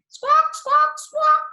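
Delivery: shrill high squawks, loud and sharp; squawking pigeons. A narrator's reading. Narrator imitating pigeons flapping out of the chimney, high loud squawks.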